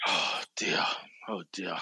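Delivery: whispered. Real, not acted. Reacting to shocking story.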